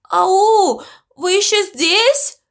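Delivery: high, drawn-out anxious call; breaking-voice call. A narrator's reading. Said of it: "срывающимся голосом крикнул он".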